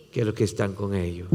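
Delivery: usual speaking voice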